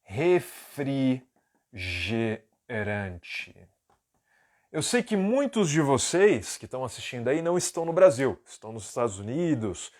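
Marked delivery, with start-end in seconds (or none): 0.0-3.7 "refrigerante" stretched very slowly syllable by syllable; "refrigerante" bem arrastado; drawn out. Shown as a demonstration. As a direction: drag "refrigerante" out for the class. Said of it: "que significa".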